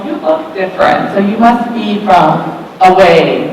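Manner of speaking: ordinary delivery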